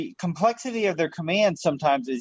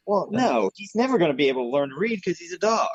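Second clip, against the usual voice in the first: ordinary delivery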